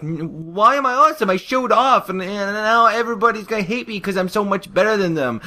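high, loud, whining panic; distressed pony. Acted. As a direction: wail it in a hammed-up high voice. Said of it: "And she's like".

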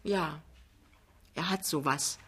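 soft, halting admission; quiet, hesitant young wife. A narrator's reading. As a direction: admit it reluctantly and quietly. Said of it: "sagt Lämmchen zögernd".

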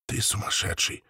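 hushed whisper, shaking his head. Acted. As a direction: breathe it out in disbelief. Inca shaken, whispered.

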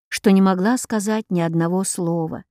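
ordinary delivery

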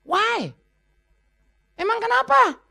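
high, put-on indignant reply; high mock-defensive "why, so what". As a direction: play the one who shrugs off his roots.

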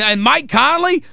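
ordinary talk

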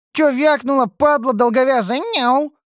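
very high, loud catgirl fury; furious high-pitched yowl; enraged. A narrator's reading. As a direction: spit the insult in a shrieky high voice. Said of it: "взъярилась Рен".

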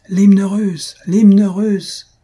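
high, loud demand from a whole audience; crowd shouting. A narrator's reading. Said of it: "toute la salle de crier". (clameur aiguë et forte de la salle)

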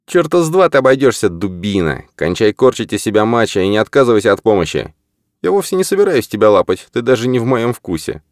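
high, heated angry outburst. Acted. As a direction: snap at him in anger. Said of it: "Лицо фотографа исказилось от гнева".

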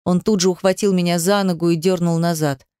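plain reading voice

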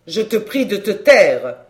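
loud, angry reproach. A narrator's reading. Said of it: "dit Julien avec colère".

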